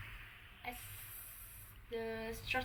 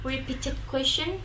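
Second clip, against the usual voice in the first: usual speaking voice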